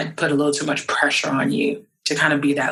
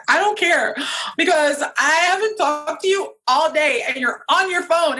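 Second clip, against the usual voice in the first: upset outburst; 12 semitones higher than usual. Retold or imitated. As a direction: throw it back at him, hurt and angry. Retold fight with husband.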